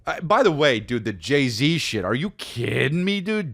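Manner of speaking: usual speaking voice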